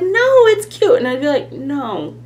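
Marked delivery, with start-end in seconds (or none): 0.0-1.0 very high, loud mock-sweet insistence; reassuring friend. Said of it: "people would be like". 1.5-2.2 sulky, softer pouting no; contradicting friends' praise like a pouty toddler. Retold or imitated. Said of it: "I was such a pouty toddler".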